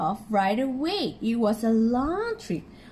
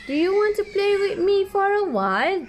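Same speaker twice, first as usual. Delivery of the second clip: put-on higher, light boy's voice; boy asking; 8 semitones higher than usual. George inviting the alien to play, in a higher boy's voice. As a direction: ask it like an eager young boy. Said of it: "George asked".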